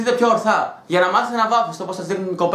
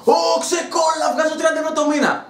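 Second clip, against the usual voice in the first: mock exasperated shout; 7 semitones higher than usual. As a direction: shout it like you've had enough. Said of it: "είμαι σε φάση να λέω".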